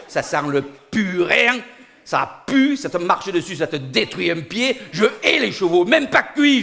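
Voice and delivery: comic fury, peaking high and loud; indignant rant. Acted. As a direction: build to a loud high outburst of loathing. Comic rant against horses.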